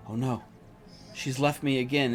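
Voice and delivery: mildly fearful, voicing someone left alone; scared little voice. A narrator's reading. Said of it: "she's, like, looking around scared".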